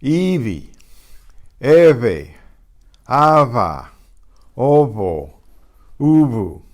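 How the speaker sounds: Ivi, Eve, Ava, Ovo, Ubu with a light buzzing V; buzzy soft V between vowels. Shown as a demonstration. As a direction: drill the vowel-V-vowel syllables, loud and buzzy. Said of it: "softened to more or less a buzz".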